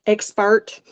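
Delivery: Southern-accented "expert", set apart by a pause. Real, not acted. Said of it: "love my Southern accent".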